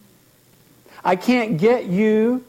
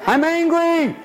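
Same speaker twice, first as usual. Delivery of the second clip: much higher, put-on petulant voice; whiny client; 11 semitones higher than usual. Therapist mimics a client answering, petulant whine. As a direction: pout it out like a sulky child. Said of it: "how you feel about it?".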